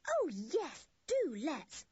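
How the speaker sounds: put-on high-pitched girlish delight; delighted cry. A narrator's reading. Anne jumps at the dinner invitation, delighted. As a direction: burst out eagerly, pitched high. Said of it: "cried Anne in delight".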